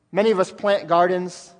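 ordinary talk, nothing marked

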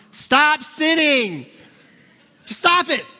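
high, loud, slow shout; mock counselor yelling. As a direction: yell it at the counselee. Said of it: "I wouldn't really do that".

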